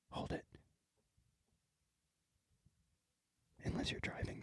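hushed whispered meditation aside; whispering. Pretend. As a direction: whisper it like a calm guide.

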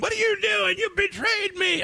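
high-pitched mock outraged yelp; mad son imitation. A dad mimics his son mad at mom over a show, high mock outrage. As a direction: squeal it like a betrayed, ugly-tempered kid. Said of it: "he got mad at his mom".

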